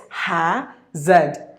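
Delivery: "ha-zed" in two parts, no R; British pronunciation. Shown as a demonstration. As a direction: say "ha-zed" British-style, split by syllable. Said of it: "If you go to the British way".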